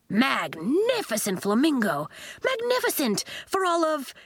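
high, exultant exclamations; delighted praise. A narrator's reading. Coach praises Nori's flamingo, delighted. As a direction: swoop up high in admiration.

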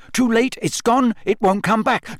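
high, panicky recitation; horror-struck recital. A narrator's reading. Harry finishing the riddle, staring horror-struck. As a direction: recite doom with mounting dread. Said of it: "staring horror-struck at the elf".

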